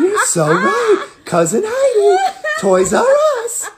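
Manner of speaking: very high, slow, laughing cartoon voice; squeaky cartoon character voice. Voice actor greets a fan in his cartoon voice. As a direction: pitch way up into a giggly creature voice.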